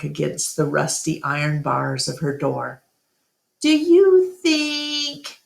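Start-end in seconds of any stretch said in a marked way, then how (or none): 3.6-5.4 very slow, higher small voice; a frightened little elephant, hesitant; timid child. A narrator's reading. Ruby nervously asks Ivan about dying, timid and slow.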